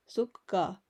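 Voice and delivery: ordinary delivery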